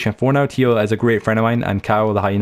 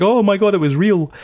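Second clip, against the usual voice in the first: higher-pitched imitation of an amazed reaction; mock amazement; 10 semitones higher than usual. Retelling the reaction to a mothership video, mock amazed. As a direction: quote it as an awestruck, high-pitched exclamation.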